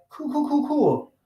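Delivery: high, repeated deadpan cool; sarcastic cool. Retold or imitated. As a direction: fake approval, very high and quick. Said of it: "And I was like".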